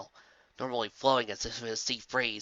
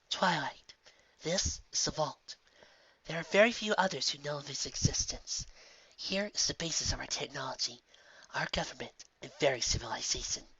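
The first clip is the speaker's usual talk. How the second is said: grave princess; 2 semitones higher, 6 dB quieter than usual. Acted. Grave princess reveals vault.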